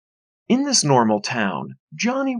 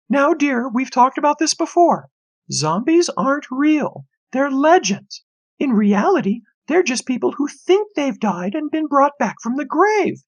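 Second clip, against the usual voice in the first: patronizing mother; 13 semitones higher than usual. A narrator's reading. Mother gently lecturing her son about zombies, high voice. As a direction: explain it like a patient mother.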